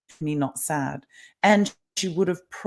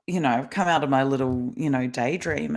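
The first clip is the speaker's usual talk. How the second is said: ordinary talk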